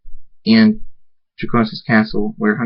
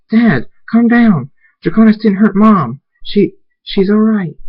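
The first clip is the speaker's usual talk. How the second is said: desperate pleading; 11 semitones higher, 5 dB louder than usual. Acted. Daughter begs her raging father to calm down, desperate high plea. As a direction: plead loudly up in a high register.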